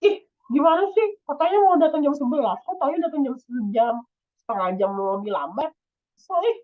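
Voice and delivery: high, whiny scolding of a late friend; angry complaining. Shown as a demonstration. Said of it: "kalian marah-marah".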